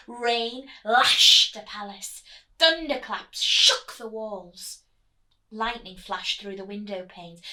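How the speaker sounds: booming storm narration. A narrator's reading. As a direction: roar it out like thunder.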